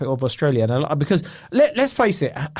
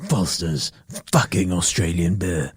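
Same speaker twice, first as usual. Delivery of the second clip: boss voice; 8 semitones lower, slower than usual. Pretend. Boss slogan.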